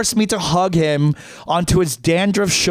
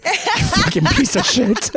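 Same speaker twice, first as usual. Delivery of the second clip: mock scolding; 12 semitones higher than usual. Mock scolding dead friend.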